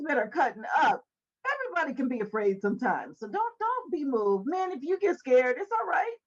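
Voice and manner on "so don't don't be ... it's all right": ordinary delivery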